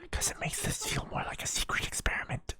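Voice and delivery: secretive hushed whisper. Acted. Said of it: "You got me doing it".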